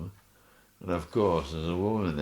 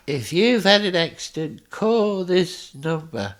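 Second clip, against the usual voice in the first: much higher, much louder, slow ad voice; mock advert voice; 12 semitones higher, 9 dB louder than usual. Friend [name] mocking him with a claims advert. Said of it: "He says".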